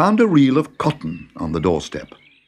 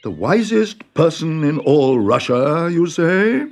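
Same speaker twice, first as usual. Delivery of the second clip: the Tsar, higher and slow in disbelief; haughty Tsar; 6 semitones higher, slower than usual. Acted. The Tsar repeats the farmer's boast in haughty disbelief. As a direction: echo it like a suspicious monarch.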